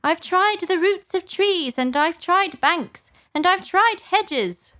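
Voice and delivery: high, put-on bird voice, drawn out; distressed pigeon. A narrator's reading. Pigeon ignoring Alice, exasperated lament. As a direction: list each failure in high exasperation.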